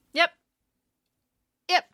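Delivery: usual speaking voice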